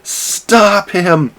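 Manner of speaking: mock panicked yell; frantic calling out. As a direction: call for help as he flails in. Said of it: "It's like".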